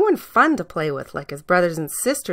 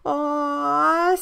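put-on little girls cooing; 8 semitones higher than usual. A narrator's reading.

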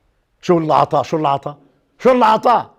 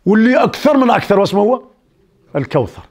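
usual speaking voice

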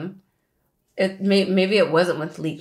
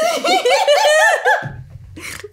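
high-pitched burst of laughter; 5 dB louder than usual. Real, not acted. Reacting to dark theory.

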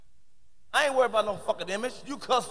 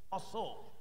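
mock Chinese greeting, clipped and soft; quick bow-like greeting word; Chinese hello; 3 semitones lower, 14 dB quieter than usual. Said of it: "they go".

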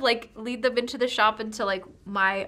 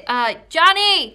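calling out; 4 semitones higher, 7 dB louder than usual. Acted. Shopkeeper calls for employee's help.

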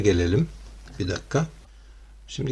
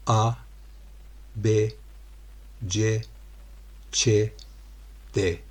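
slow, separate letters with pauses; dictating letter by letter; 4 dB quieter, slower than usual. Shown as a demonstration. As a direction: spell it out one letter at a time. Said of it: "Şöyle".

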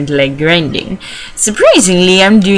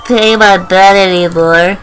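put-on character voice; 3 semitones higher than usual. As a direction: voice a game character, dragging the words. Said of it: "Don't remember what his voice is".

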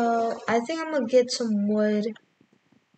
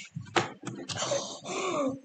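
sharp breathy gasp; gasp of fright; 9 semitones higher, 6 dB quieter than usual. Real, not acted. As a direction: gasp in shock. After the scare.

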